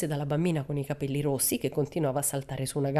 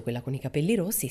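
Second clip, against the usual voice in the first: ordinary delivery, nothing marked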